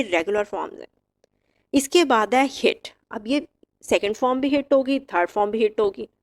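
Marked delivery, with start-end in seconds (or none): none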